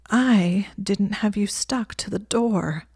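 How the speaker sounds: strained breathy words, slow; forced out. Acted. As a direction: push each word out through tension. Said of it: "she forced the words out".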